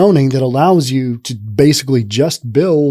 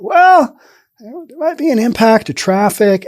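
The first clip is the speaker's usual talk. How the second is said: mocking the residents; 8 semitones higher than usual. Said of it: "They wanna talk about".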